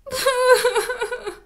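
high-pitched sobbing wail; high crying wail. Acted. Trapped rabbit wails in the trap, high crying wail. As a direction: let out a high little wail of tears. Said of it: "pleura une autre voix".

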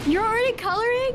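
high, loud surprised question; shocked disbelief. Real, not acted.

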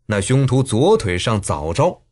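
ordinary delivery, nothing marked

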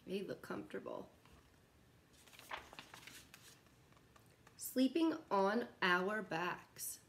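slow, gentle storybook read with long pauses; reading to children. Acted. Teacher reading a bedtime picture book to kids, slow and gentle. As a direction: lull the little ones toward nap time.

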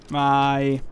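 ordinary talk, nothing marked

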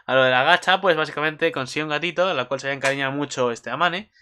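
ordinary talk, nothing marked

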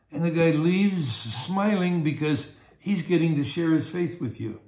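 normal talking voice

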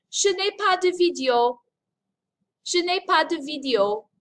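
Je n'ai pas de vidéo said high and loud, word by word; French pronunciation model. Shown as a demonstration. Teacher modelling a Zoom phrase for students to repeat. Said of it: "Listen carefully to how I say it and repeat after me".

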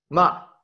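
short, high MA dropping sharply; falling tone. Shown as a demonstration. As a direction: start MA high and drop it quickly.

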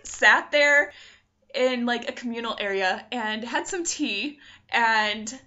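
ordinary delivery

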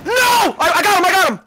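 high, loud victory yell; excited shout. Real, not acted. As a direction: scream it out in triumph. Clutch kill mid-round.

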